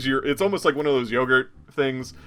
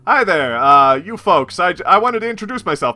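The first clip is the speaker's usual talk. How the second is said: louder, higher, eager newsman; eager reporter voice; 5 semitones higher, 6 dB louder than usual. Acted. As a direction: greet them like an eager reporter. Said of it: "and he goes".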